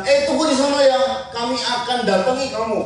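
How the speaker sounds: high, loud warning call; enemy calling out. Acted. Philistines tell the pair to wait there, calling out. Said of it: "lalu orang itu bilang".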